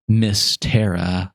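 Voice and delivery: ordinary talk, nothing marked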